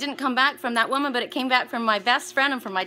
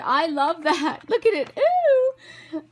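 high, loud excited cooing; gush, rising into a high squeal; thrilled, laughing and climbing higher; gushing delight; 7 semitones higher, 5 dB louder, much slower than usual. Real, not acted. Re-living and showing delight at gift.

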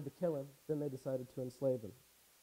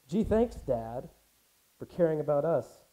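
sarcastic; 9 dB louder than usual. Acted. Slighted sons answering their father, sarcastic. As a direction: thank him with obvious sarcasm.

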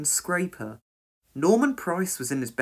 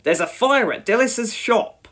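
raised, loud announcement; urgent order; 7 semitones higher, 5 dB louder than usual. A narrator's reading. Station officer alerts crew to a fire, urgent order. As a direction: announce the emergency forcefully. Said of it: "he ordered".

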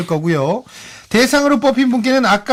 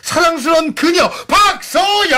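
winner announcement; 7 semitones higher, slower than usual. Acted.

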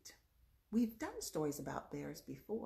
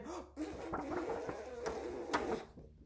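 long blowing breath; 10 semitones higher than usual. Acted. Wolf blowing hard.

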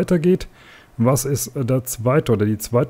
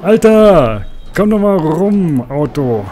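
frustrated yell; 3 semitones higher, 5 dB louder, slower than usual. Real, not acted. Yelling at the car in a turn.

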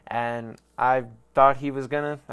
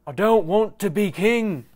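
hammed-up northern English accent, much higher and louder; bad Jon Snow accent; 7 semitones higher, 8 dB louder than usual. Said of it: "That was another shitty accent".

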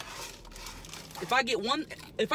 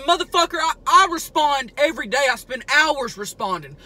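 louder, indignant retort; heated mock comeback; angry retort; 5 semitones higher, 8 dB louder than usual. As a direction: tell off the commenter. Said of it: "And I said".

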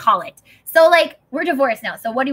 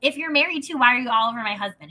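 higher, sharp challenge; wife confronts the woman, higher pitched; sarcastic retort; 5 semitones higher, 4 dB louder than usual. Retold or imitated. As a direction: needle her with disbelief. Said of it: "And I'm like".

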